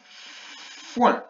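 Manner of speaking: FOIN with a long hissed F before the vowel; drawn-out first sound. Shown as a demonstration. Teacher sounding out foin for pupils, drawn-out first sound. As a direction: stretch the F of Foin, then land the OIN. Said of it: "on va déjà frapper les syllabes".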